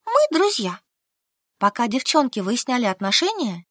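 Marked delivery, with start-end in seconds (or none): 0.0-0.8 высоко и громко, с возмущением; Солька отбивается от намёка подруги; возмущённо. A narrator's reading. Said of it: "возмутилась Солька". (возмущённо и высоко)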